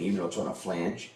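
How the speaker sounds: ordinary talk, nothing marked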